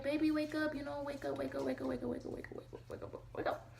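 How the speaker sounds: high, repeated plea fading into breathless whisper; re-enacted frantic pleading. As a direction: plead with the baby, high, then trail away to a whisper. Re-enacts trying to wake her baby.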